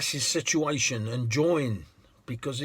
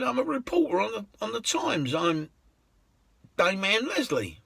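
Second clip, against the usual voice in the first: much higher woman's voice, proud; proud lady; 8 semitones higher than usual. Retold or imitated. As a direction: list her achievements.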